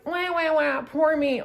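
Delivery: high-pitched mock wailing; exaggerated, high boo-hoo mockery; mocking whine. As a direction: whine it like a fake crybaby. Mocking a whiny complaint.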